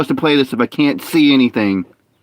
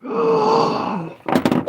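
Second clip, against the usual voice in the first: high startled gasp; sharp gasp; 5 dB quieter than usual. Real, not acted. Gamer gasping when a mob jumps out. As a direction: suck in air in shock. Sudden scare in game.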